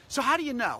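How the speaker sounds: normal talking voice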